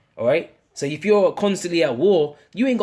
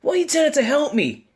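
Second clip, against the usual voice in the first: high, nagging whine; shrill mock complaint, much higher; whiny Martha; 11 semitones higher than usual. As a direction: needle him like a fed-up sister. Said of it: "she was bitching to Yahweh Shai".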